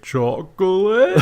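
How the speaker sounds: high, drawn-out monster voice; Sloth. Retold or imitated. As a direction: stretch it out in childlike wonder. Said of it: "and he's like".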